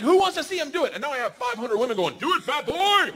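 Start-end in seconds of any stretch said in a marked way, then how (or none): 0.0-0.9 high, projected host call; mimicked show host calling out; rousing the crowd. 2.2-3.1 high mock heckle; crowd yell. Crowd of women chanting, acted out.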